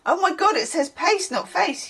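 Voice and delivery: high, amused realisation; surprised realisation. Real, not acted. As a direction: realise your mistake with a jolt. Realising misreading.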